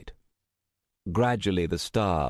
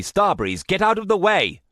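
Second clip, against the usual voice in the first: higher, louder angry order; angry shout; 13 semitones higher, 7 dB louder than usual. Acted. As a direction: shout her out of the way. Ordering her aside angrily.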